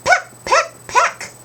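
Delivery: very high, loud pecks like a bird; squeaky bird pecks at the door; high pecking beak. Shown as a demonstration. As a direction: peck it out with a tiny beak. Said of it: "Pretend you have a little beak".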